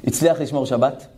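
ordinary talk, nothing marked

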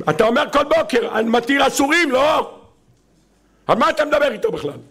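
high-pitched indignant rebuke; acted outburst of exasperation; exasperated scolding. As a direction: scold like an incredulous, hurt father. God acted as exasperated, scolding father.

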